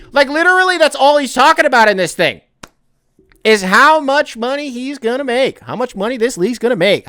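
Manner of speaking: heated yelling rant; mad rant. Real, not acted. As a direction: let the frustration boil over. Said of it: "I sound like a guy who's kinda mad".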